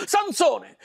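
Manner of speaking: high, angry cry of his name; angry call. Acted. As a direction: call him out in anger. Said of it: "si arrabbiò moltissimo".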